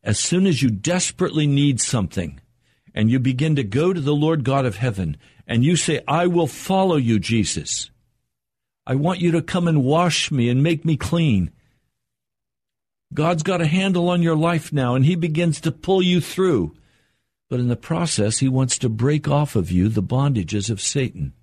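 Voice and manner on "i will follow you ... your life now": raised higher, pleading to Jesus; earnest prayer. Retold or imitated. Believer crying out to Jesus, higher and pleading. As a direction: plead like a new believer reaching for God. Believer praying to God, quoted.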